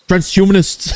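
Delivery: put-on high caricature Indian accent; high-pitched comic Indian accent, close to laughing; mock Indian accent. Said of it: "this is my Indian impression of Lois".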